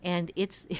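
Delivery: ordinary talk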